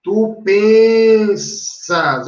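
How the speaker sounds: slow, emphatic teaching demo; slow stress demo. Shown as a demonstration. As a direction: stretch "pensas" to show the stressed radical. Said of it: "aqui é uma forma risotônica".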